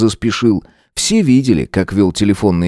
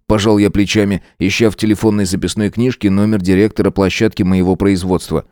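usual speaking voice

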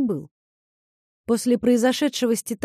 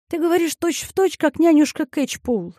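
complaining, higher pitch; 6 semitones higher than usual. Acted. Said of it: "пожаловалась Дейзи".

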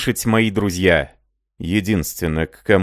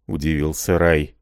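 ordinary delivery

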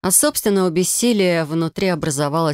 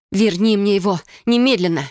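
a furious witch's command; fierce demand; 4 semitones higher than usual. A narrator's reading. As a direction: order the spell to return him. Commanding incantation over blood.

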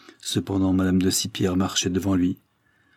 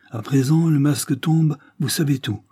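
nettement plus aigu et plus fort; révélation éclatante; 6 semitones higher, 4 dB louder than usual. Acted. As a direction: lance la vérité avec force. Révélation accusatrice de la mère. (éclat de voix accusateur)

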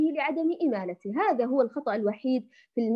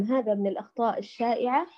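ordinary delivery

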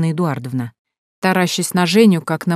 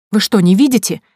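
louder, higher, indignant question; 4 semitones higher, 7 dB louder than usual. A narrator's reading. Woman alerting the relatives, indignant. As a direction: demand that they open their eyes. Liza exposes them to relatives.

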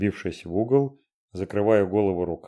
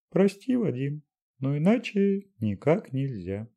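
Dashenka voice; 7 semitones higher than usual. A narrator's reading. Dashenka voiced apologising, slow.

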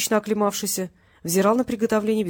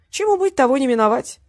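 carefree; 6 semitones higher, 5 dB louder than usual. A narrator's reading. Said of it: "беспечно произнёс Васильев".

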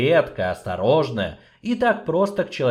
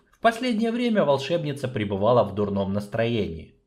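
ordinary delivery, nothing marked

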